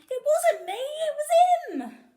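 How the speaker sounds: high, very loud whining protest, slow end; blaming robber. A narrator's reading. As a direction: point the finger in panic. Robbers blame each other.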